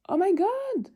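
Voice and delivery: high, loud amazed exclamation; excited. Real, not acted. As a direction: burst out at the mountain view. Sudden view of mountains.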